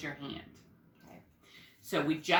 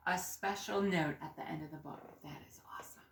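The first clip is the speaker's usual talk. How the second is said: whisper; 6 dB quieter, faster than usual. Real, not acted. Teacher hushes over the book.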